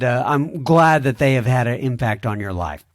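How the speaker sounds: ordinary delivery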